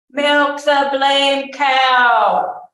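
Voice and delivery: slow, high, loud roar. A narrator's reading. Soldier ordering someone to milk the cow, roaring. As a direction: roar the order, drawing the words out. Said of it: "the man roared his bad words".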